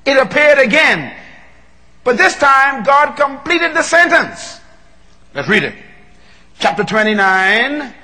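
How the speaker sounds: high, loud, sustained preacher's cry; roaring revival preaching; preaching at full force. Acted. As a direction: hold the pulpit at full fire. Preacher at climax of sermon.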